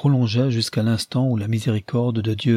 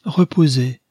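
raised plea; 4 semitones higher, 6 dB louder than usual. Acted. Mother begs the ghost.

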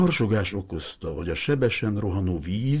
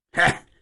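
higher, louder cracked grunt; crackling grunt; 11 semitones higher, 5 dB louder than usual. Acted. Old fisherman's voice cracking out to get attention. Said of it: "recsent egyet az öreg Hiribi hangja".

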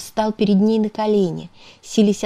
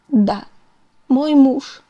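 tearful; 7 semitones higher, 4 dB louder, slower than usual. A narrator's reading. Wife confirms husband hit her.

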